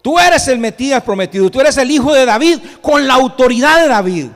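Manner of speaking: loud, high acted proclamation; forceful, ringing declaration; shouting full-lunged. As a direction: proclaim it at the top of your lungs. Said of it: "Están gritando a, a todo pulmón".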